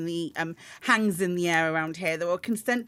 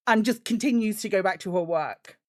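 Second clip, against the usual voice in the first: usual speaking voice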